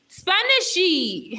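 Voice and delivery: high, loud, drawn-out call. Real, not acted. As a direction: call out playfully for someone missing. Calling out for a missing viewer.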